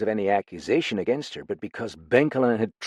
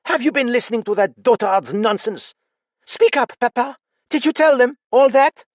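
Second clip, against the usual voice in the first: angry; 8 dB louder than usual. Acted. Angry daughter confronts father.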